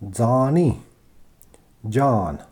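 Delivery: normal talking voice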